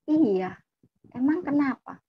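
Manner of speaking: unbothered, mildly challenging answer; casual reply. Retold or imitated. Younger sibling answers scandalised older sibling, casually. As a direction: shrug it off. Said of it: "Saya jawabnya santai".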